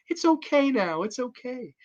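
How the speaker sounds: put-on calm a scared child you can't see; gentle coaxing. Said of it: "she walks in the room".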